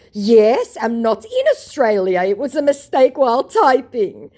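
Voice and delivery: high, loud mock scammer. Mocking scammer's typo excuse to her, high and loud. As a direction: parody his flimsy excuse.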